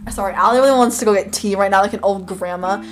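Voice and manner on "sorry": ordinary delivery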